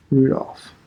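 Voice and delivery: usual speaking voice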